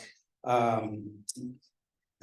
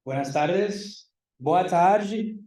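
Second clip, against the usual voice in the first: faked Spanish greeting; 5 semitones higher, 6 dB louder, slower than usual. Shown as a demonstration. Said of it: "I've been faking it for years".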